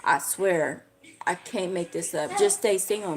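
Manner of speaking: ordinary delivery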